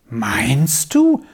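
wondering, drawn-out question; a touch of disbelief, rising at the end; astonished. A narrator's reading. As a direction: ask in mild amazement. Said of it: "fragte Lilli erstaunt".

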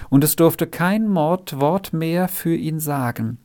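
usual speaking voice

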